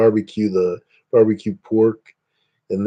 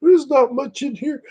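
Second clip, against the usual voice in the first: mock friend quote. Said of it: "she said".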